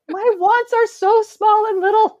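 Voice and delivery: very high, slow little voice; tiny pleading voice. Acted. Tired mother saying how little she wants, tiny pleading voice. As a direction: squeak it out slowly, mock-pitiful.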